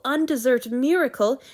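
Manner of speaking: plain reading voice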